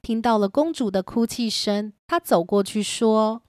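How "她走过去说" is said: ordinary delivery, nothing marked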